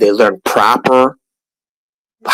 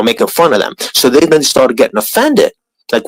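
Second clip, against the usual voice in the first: ordinary delivery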